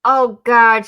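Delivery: normal talking voice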